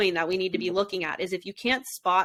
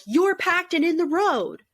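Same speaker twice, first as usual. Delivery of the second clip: mocking accusation; 10 semitones higher, 4 dB louder than usual. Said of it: "Aaron's like".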